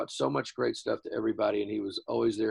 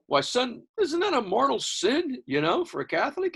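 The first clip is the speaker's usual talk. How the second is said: much higher, slowed mock-outraged dad; 13 semitones higher, 3 dB louder than usual. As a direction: ham up the pious father's shock.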